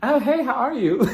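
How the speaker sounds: high, loud, gushing mock hello; hammed-up squeal of insincere welcome; fake friendliness. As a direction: imitate a phony greeting to the next guest. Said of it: "this fake ass conversation between them".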